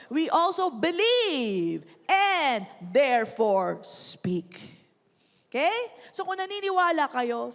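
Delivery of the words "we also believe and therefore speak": "and" stretched, "speak" fading to a breath; slow declaration. Shown as a demonstration. Pastor leading the congregation in the verse, slow. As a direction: declare each word slowly, ending almost breathed. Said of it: "Sabihin natin lahat".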